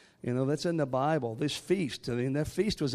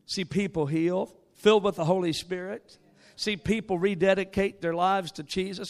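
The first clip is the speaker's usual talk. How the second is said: ordinary delivery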